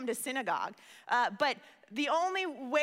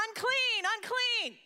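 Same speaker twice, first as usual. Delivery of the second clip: calling out unclean; 10 semitones higher, slower than usual. Retold or imitated. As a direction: cry it out high so people keep away. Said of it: "without having to yell out".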